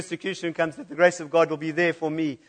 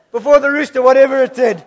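high, loud, breaking into laughter; real giggling fit; laughing; 8 semitones higher, 11 dB louder than usual. Real, not acted. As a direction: give up mid-line laughing. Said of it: "we're all laughing".

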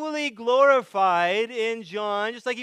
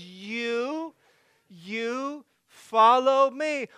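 slow, raised YOU, you follow me; emphatic command; 3 semitones higher, 3 dB louder, much slower than usual. Retold or imitated. Jesus grabbing a distracted disciple, emphatic command. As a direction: grab the listener with a drawn-out You. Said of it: "he just grabs you, says".

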